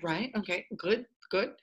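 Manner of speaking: soft, noncommittal reply, retold; underplayed approval. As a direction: humour them quietly, barely impressed. Humouring people's basic French.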